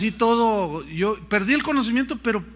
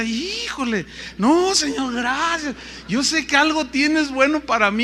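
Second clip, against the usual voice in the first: higher, louder, urgent thanks to God; fervent praise; 8 semitones higher, 5 dB louder than usual. Retold or imitated. Preacher on the cliff edge cries out thanks to God. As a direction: cry out thanks over the abyss.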